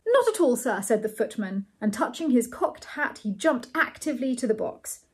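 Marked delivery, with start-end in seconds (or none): 0.1-0.8 high, loud deferential reply; footman's voice. A narrator's reading. Footman answers the thanks, high loud voice.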